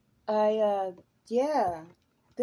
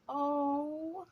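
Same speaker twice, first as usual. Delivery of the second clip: normal talking voice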